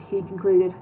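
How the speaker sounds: usual speaking voice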